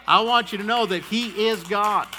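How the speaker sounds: ordinary talk, nothing marked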